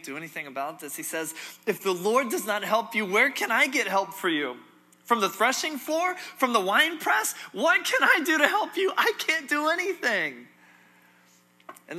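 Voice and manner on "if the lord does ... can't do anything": loud, high-pitched exasperation, climbing with each question; exasperated outburst. Acted. Powerless king to starving woman begging help, exasperated outburst. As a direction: throw up helpless questions at full voice.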